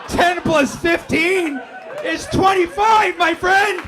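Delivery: yelled, drawn-out triumphant sum; slow, loud, gloating count; triumphant shout. Real, not acted. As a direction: bellow it like a victory.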